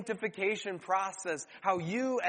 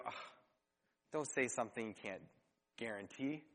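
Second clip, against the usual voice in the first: low, much softer, quick sinking sigh; inner groan; 8 semitones lower, 10 dB quieter, faster than usual. Retold or imitated. Listener hearing a flaky promise, groaning under breath. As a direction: mutter it to yourself as your heart sinks.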